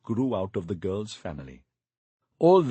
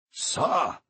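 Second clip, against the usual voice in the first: shouted; 5 semitones higher than usual. Acted. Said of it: "I shouted".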